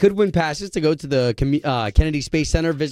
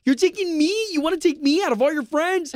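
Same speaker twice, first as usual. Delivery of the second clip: excited; 9 semitones higher than usual. Retold or imitated. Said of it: "they'd be like".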